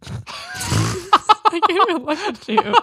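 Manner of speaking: high, slow, laugh-filled squeal; bursting with helpless laughter; trying not to laugh. Real, not acted. Helpless giggling.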